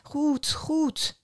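high-pitched, hurried-to-agree tone; flustered giving in. A narrator's reading. Boy gives in to the begging king, flustered.

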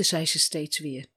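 plain reading voice

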